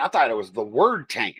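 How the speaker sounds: ordinary delivery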